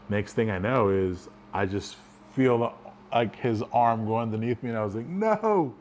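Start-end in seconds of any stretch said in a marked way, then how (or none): 5.1-5.7 high, swooping cry of dismay; mock alarm. Man feeling his buddy's arm go underneath him, mock alarm. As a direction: let it leap up in pitch, caught too late. Caught in choke, acted reaction.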